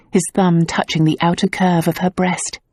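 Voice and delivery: ordinary talk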